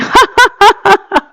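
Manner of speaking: high, pealing laugh; hearty laugh to get others laughing. Shown as a demonstration. Asking the audience to laugh along, high laugh.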